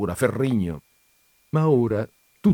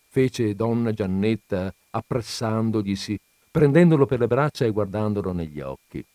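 ordinary delivery, nothing marked

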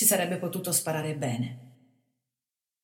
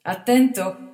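cheerful murmur; 2 semitones higher, 10 dB louder, slower than usual. A narrator's reading. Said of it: "mormorò allegramente".

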